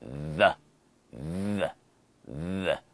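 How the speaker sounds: The with a buzzing voiced th, repeated; voiced th demo. Shown as a demonstration. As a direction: buzz the tongue between the teeth on the. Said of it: "add a voiced sound to the T H".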